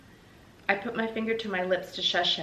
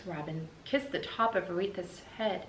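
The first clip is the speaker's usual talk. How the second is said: usual speaking voice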